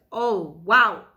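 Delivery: can't believe what the doctor just said; stunned. Retold or imitated. Patient told to just bleed it out, stunned. Reacting to cold doctor advice.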